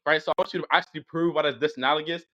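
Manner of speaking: normal talking voice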